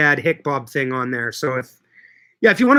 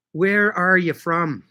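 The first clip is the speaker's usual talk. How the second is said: much slower, spaced-out question; slow, deliberate repeat; 3 semitones higher, much slower than usual. Real, not acted. Asking a livestream viewer where they're from, slowly and deliberately. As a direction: draw the question out word by word.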